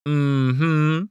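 high, drawn-out knowing hum; smug little affirmation. Acted. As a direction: hum it with told-you-so satisfaction. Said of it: "Flo said knowingly".

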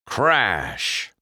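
shouted crash, falling away; dramatic crash call; crash sound effect. A narrator's reading. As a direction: bang out the word like impact. Narrator voices crash sound.

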